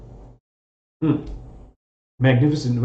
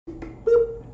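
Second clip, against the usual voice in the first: high squeaky boop. Pretend. Sound effect while zooming image.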